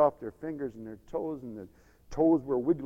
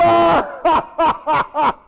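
loud, high mocking cackle; hammed-up taunting ha-ha; jeering laugh; 14 semitones higher, 15 dB louder than usual. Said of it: "he jeered him".

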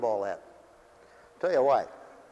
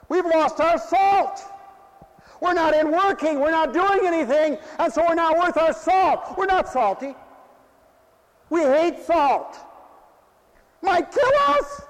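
higher, louder, slow preaching cadence; full-force preaching; 10 semitones higher, 5 dB louder than usual. Acted. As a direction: preach it out with hallelujahs.

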